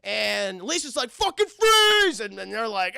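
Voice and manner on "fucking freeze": very high, loud, slow yell; police shout. Retold or imitated. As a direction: bellow the order from the helicopter. Elisa, a cop, yelling an order.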